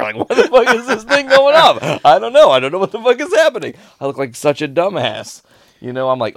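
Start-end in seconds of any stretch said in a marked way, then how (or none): 0.2-3.7 high, loud, hammed-up bewilderment; imitating your own frantic confusion, half laughing; mock baffled panic. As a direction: shout it high like a comically exasperated retelling. Acts out his baffled panic retold.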